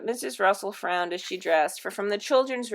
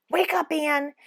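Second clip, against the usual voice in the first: higher urgent warning; urgent wake-up call, pitched up to alarm; scary, urgent; 7 semitones higher than usual. A narrator's reading. Joan scaring her twin sister awake, scary and urgent. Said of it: "making her voice scary and urgent".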